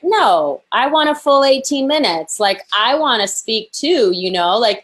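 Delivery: insistent retort. Retold or imitated. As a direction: insist on your right to speak. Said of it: "And I was like".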